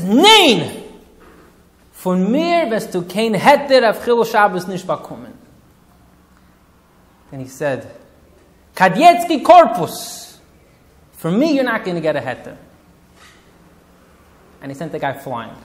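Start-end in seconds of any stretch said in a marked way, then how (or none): 0.0-5.3 loud, higher, furious no in Yiddish; stern refusal. Retold or imitated. Rav refusing the younger man a heter, angry loud shout. 8.7-12.6 furious rebuke. Retold or imitated. Rav scolding the young man, loud angry shout.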